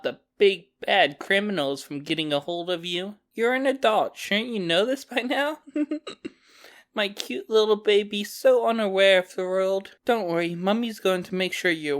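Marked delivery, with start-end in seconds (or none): 5.6-6.3 soft high giggle; breathy mocking laugh. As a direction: giggle at the captive's expense. Villain mocks captive.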